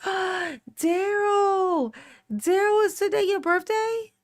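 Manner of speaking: gasp, then a high drawn-out call; surprised call. Real, not acted. Calls out to birthday viewer.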